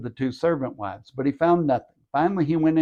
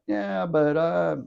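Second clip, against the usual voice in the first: higher-pitched, drawn-out wavering; hesitant objection; 5 semitones higher, much slower than usual. Acted. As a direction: waver as if looking for a way out. Imagined wavering reply to God's warning.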